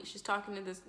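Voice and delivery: usual speaking voice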